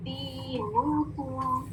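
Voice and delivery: slow, soft दीनुकुम with long vowels; rounded double "oo". Shown as a demonstration. Teacher demonstrating rounding, slow drawn-out recitation. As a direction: hold दीनुकुम level, rounded on "noo" and "koo". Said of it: "rounding और double ऊ sound".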